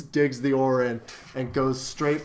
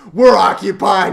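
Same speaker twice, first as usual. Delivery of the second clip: high, loud acted retort; loud mock rebuff; 5 semitones higher, 12 dB louder than usual. Man at dinner turning away other guests, loud rebuff. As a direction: shout them away from the table.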